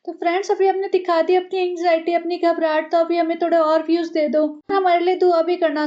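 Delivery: mock vlogger plea. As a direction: beg for views in a fake sweet voice.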